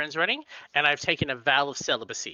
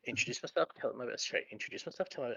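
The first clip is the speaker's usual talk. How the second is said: muttering softer and softer; fading mutter; 3 semitones lower, 11 dB quieter, faster than usual. Acted. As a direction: repeat it under your breath, fading away. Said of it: "as I say that over and over".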